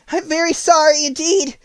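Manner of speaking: put-on high, loud, alarmed little girl; frightened girl. A narrator's reading. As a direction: cry the apology out in alarm. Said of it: "who was truly frightened".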